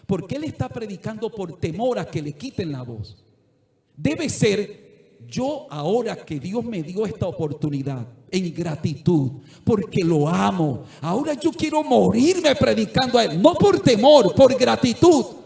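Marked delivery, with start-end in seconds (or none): 4.0-15.4 loud, rising sermon climax; preaching at full force. Acted. Preacher crying out his gratitude to the congregation, full force.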